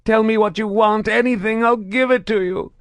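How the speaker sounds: high, loud frantic pleading; desperate begging. A narrator's reading. Prisoner begs his captor, high and loud. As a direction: plead for your life. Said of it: "he begged".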